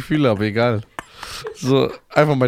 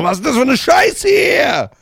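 mock angry rant; 12 semitones higher, 5 dB louder than usual. Imitating Costa snapping at a fan, mock furious shout. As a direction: rage like a grumpy star. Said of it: "Der so".

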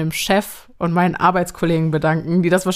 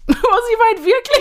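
laughing, much higher, touched; surprised laugh; 4 dB louder than usual. Real, not acted. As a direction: squeal out surprised and moved. Touched by partner's thanks.

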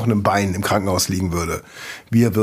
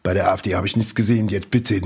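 ordinary talk

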